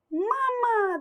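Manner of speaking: high squeaky duckling calling; baby duck. Acted. Duckling calling Pip its mother, squeaky and high. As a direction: cry out like a newborn duckling.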